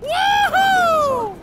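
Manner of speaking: a high, loud cheer; triumphant whoop. Real, not acted. Gamer flying the ship, triumphant whoop. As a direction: whoop with joy mid-flight.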